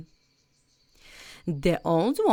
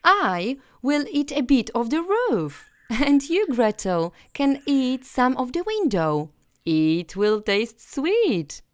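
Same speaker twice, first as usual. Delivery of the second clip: put-on higher, louder boyish glee; a hungry boy making a plan; eager Hansel; 7 semitones higher, 4 dB louder than usual. Hansel telling Gretel what to eat, higher and eager.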